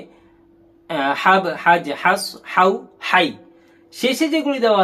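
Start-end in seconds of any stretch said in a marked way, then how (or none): 1.2-3.3 হাব, হাজ, হাস as separate blended syllables; joined reading. Shown as a demonstration. Teacher reading the first five for pupils, joined syllable drill. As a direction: read হাউ and হাই each as one joined sound. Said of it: "যদি আমরা পড়ি এইভাবে".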